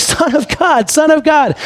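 high-pitched mock holler; retold shout for attention; imitated yelling. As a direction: call out high, without full volume. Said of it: "yelling at the top of their lungs".

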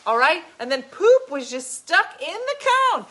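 ordinary talk, nothing marked

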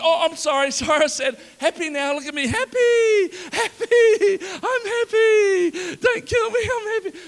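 high, loud, laughing panicked pleading; frantic fake happiness. Preacher as scared Nehemiah to the king, panicked high laughing plea. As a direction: plead that you're happy so he spares you. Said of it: "if I was Nehemiah, I would have been".

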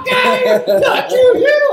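high, loud laughing shout; incredulous shout. Retold or imitated. Bikers doubting him, shouted high through laughter. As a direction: yell it like they can't believe it.

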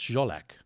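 "Zsolak" as the Polish friend pronounces it; correct Polish name. Retold or imitated. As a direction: imitate the friend saying "Zsolak" with a zh. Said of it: "it's actually not pronounced like that".

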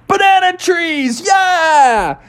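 high shouted cheer; cheering kids. Acted. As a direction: whoop it like thrilled kids.

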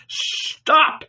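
high, abrupt cry to halt; cried command. A narrator's reading. Centurion halting the disciples, crying out. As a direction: call out a sudden order. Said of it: "he cried".

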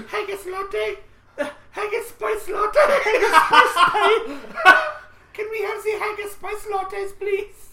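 high, slow mock German with laughs; mock German accent. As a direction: order it like a cartoon German. Mock German barista order.

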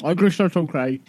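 mock talking through a mouthful; mouth full. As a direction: slur the words round stuffed-in food. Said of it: "'Cause my mouth is full".

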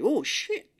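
sudden high yelp of "Oh, shit"; a quick, shocked, high-pitched curse from a mom whose mitts catch fire; startled curse. Retold or imitated. Mom's gloves set on fire.

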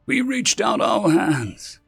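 high, slow, aching declaration; impassioned grievance. Acted. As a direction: draw it out high with wounded feeling. Ambassador's bitter grievance, first take.

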